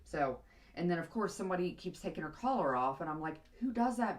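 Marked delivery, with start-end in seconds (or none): 3.6-4.2 exasperated. Retold or imitated. Exasperated about removed collar.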